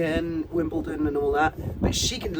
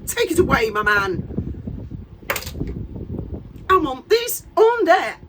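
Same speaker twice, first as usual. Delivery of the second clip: mock outrage; 6 semitones higher, 4 dB louder than usual. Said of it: "I'm like".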